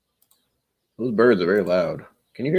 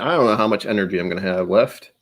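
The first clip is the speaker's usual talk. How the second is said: usual speaking voice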